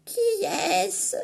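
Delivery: put-on high quavering old voice; Grandmother. Grandmother asks who is knocking, high old voice. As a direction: ask through the door. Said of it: "demande Mère Grand".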